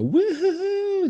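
very high, slow woo-hoo cheer; hammed-up cheering, high and drawn out; mock crowd cheering. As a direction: imitate a rowdy fan whooping. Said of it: "everyone cheering".

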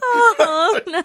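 high mock wail; mock dismay. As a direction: feign heartbreak.

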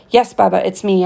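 ordinary delivery, nothing marked

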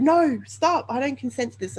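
high, loud cry of no, stop; reliving begging them to stop; panicked shout that drops at the end; retold pleading shout. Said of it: "and I said".

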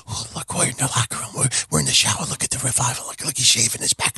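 unvoiced, low mock whisper. Usos spying on the Revival, mock whisper. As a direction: hush it like sneaky spies. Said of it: "go on the camera and go".

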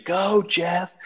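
lightly imitating wife's cheer; mock cheer. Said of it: "she's screaming".

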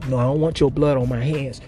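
usual speaking voice

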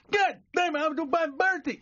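very high, loud mangled yell; raging garbled customer. Acted. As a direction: explode with mushed words. Customer losing temper in joke.

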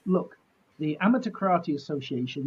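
ordinary delivery

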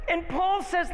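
high, loud, excited preaching over laughter; excited shouting. Real, not acted. Pastor shouting with the crowd over the fifty dollar bill, excited. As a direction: whoop it up as the bill is snatched.

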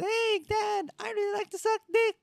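put-on high, quick sweet kid voice; way-up squeaky confession; kid falsetto. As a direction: blurt it like an innocent child. Closeted kid telling dad.

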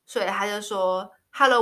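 ordinary talk

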